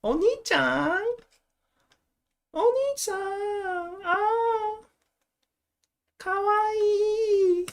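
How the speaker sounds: very high, drawn-out cutesy Japanese voice; squeaky cute Japanese voice. Acted. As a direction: put on a sugary anime-style squeak. Performing cute Japanese character voice.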